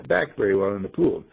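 ordinary delivery

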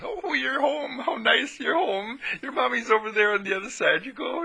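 the flock welcomes the lost lamb home, high and cooing; sheep flock voices. Retold or imitated. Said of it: "And the whole flock says".

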